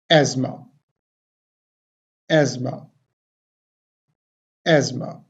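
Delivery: Asthma as "AZ-ma", T silent; correct pronunciation. Shown as a demonstration. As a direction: model Asthma with a buzzing Z, first syllable stressed. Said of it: "So how would we pronounce this word?". (Asthma का सही उच्चारण, "ऐज़मा")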